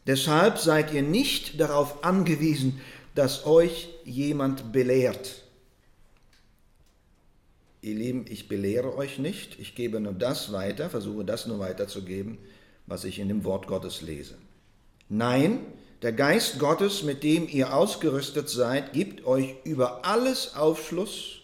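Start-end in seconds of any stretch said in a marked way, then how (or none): 0.1-5.4 ringing out the verse high and loud; forceful scripture reading. Acted. Preacher proclaiming the verse to his congregation, loud and high. 15.1-21.4 ringing out the verse high and loud; forceful scripture reading. Acted. Preacher proclaiming the verse to his congregation, loud and high.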